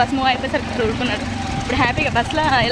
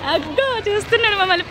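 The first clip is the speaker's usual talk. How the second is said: high-pitched, delighted call-out; real excited spotting; 9 semitones higher than usual. Real, not acted. Mother spots her little son watching from upstairs, excited. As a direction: spot him and exclaim, pitch leaping up.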